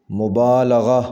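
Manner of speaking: مبالغہ with a short a after l, "mubaalagha"; correct pronunciation. Shown as a demonstration. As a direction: say مبالغہ as mu-baa-LA-gha. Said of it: "صحیح پڑھیں گے".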